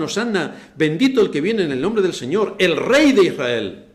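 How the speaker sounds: loud, rising joyful cry of praise; crowd's acclaim. Retold or imitated. Preacher voicing the crowd, loud festive acclaim. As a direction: shout it like a cheering crowd. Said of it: "y clamaban".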